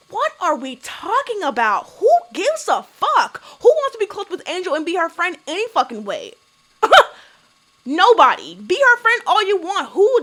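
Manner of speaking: scornful, punchy rhetorical questions; exasperated disbelief. Real, not acted. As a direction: dismiss it with loud, high-pitched exasperation. Exasperated rant at Jazzy's claim.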